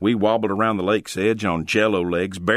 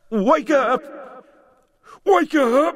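very high, loud pleading cry; desperate scream; 4 dB louder, slower than usual. Acted. Jeff shaking unconscious Logan, a desperate high scream. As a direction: scream at him to wake, over and over. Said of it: "scream over and over again".

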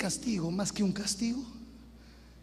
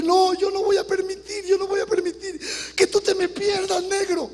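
high, loud tearful insistence; crying mother refusing to lose her son; pleading mother; 10 semitones higher, 5 dB louder than usual. Retold or imitated. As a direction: refuse to let him go, pitched high.

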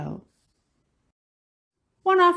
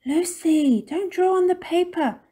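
high, loud telling-off; scolding parent; 11 semitones higher, 7 dB louder than usual. A narrator's reading. Missus Topsy-Turvy correcting Lucy's drawing, high and scolding. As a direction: scold a child in a raised, high voice. Mother scolds child in story.

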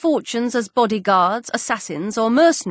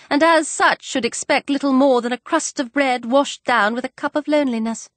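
Mrs Bennet; 3 semitones higher than usual. Acted.

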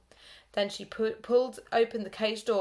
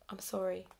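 softer mumbled sorry; sheepish apology; 6 dB quieter than usual. A narrator's reading. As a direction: mumble it looking at your shoes. Narrator apologising.

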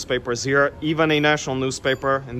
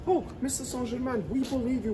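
fawning cops; 11 semitones higher, 6 dB quieter than usual. Retold or imitated. Guide voices fawning police.

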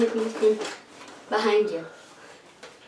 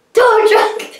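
shout; 10 semitones higher, 4 dB louder than usual. Real, not acted. Rap falls apart, shouting.

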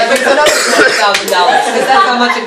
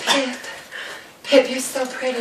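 very soft, slightly raised voice; mock timid admiration; 3 semitones higher, 11 dB quieter than usual. Biddy sweet on Pip in a retold story, mock timid and hushed. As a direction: imitate a timid girl's hushed compliment.

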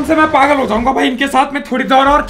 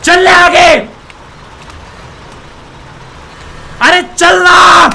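impatient shout; 4 semitones higher, 4 dB louder, much slower than usual. Real, not acted. Yelling at stuck player.

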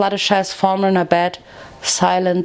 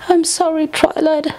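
high-pitched, shuddering apology; Fluttershy, stammering and shuddering; 8 semitones higher than usual. A narrator's reading. Tearful friend apologising to Twilight, shuddering. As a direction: apologise in a much higher, upset voice. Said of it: "she stammered, shuddering".

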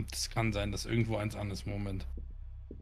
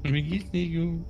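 slow eerie echo, higher; creepy ghost voice; 4 semitones higher, slower than usual. Pretend. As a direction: mimic the ghost's creepy line slowly. Friend echoes ghost voice. (gruselige Geisterstimme, langsam)

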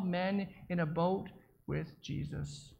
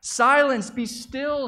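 loud, high command to the storm; 5 semitones higher, 8 dB louder, much slower than usual. Acted. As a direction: order the storm to stop with full authority. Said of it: "he commanded".

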